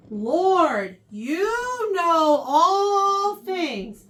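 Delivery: loud, high, very drawn-out plea; frustrated. Acted. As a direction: stretch each word in exasperation. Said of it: "I'm sure he's frustrated".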